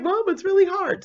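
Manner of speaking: high, helpless little plea; whiny plea. Retold or imitated. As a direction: whine it to mom. Daughter pleading with her mom.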